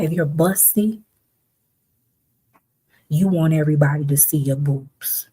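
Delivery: normal talking voice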